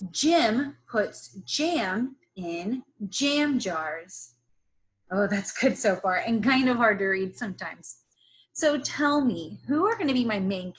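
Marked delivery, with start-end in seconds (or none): none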